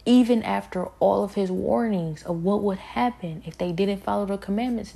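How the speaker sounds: normal talking voice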